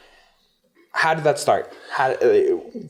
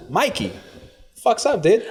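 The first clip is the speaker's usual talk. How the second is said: ordinary talk, nothing marked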